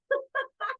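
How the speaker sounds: high, light laugh; story laughter. Acted. Reader laughs along with children at the banana joke, high laughter. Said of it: "laughing along with your children".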